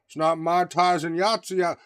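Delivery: slow Southern drawl, exaggerated; mock Southern accent. Ridiculing bad writing for co-hosts, mock Southern drawl. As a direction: stretch the line in a put-on Southern accent. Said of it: "I've never heard anybody go".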